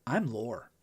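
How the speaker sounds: quiet, knowing evil-twin line; a hint of the menacing twin; sly Lore voice. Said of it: "you see Brent Spiner get that grin, and his whole demeanor changes".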